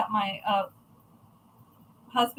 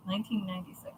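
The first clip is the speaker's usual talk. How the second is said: "Nineteen ninety-six" muttered much softer, as an aside; quiet repeat; 6 semitones lower, 13 dB quieter, much faster than usual. Shown as a demonstration. Correcting her own year to her mom, soft aside. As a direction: drop the voice and repeat "Nineteen ninety-six" under your breath.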